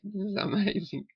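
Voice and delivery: high, bright burst of wonder; high amazed cry; amazed exclamation. Retold or imitated. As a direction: exclaim it like a sudden discovery. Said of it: "it was like".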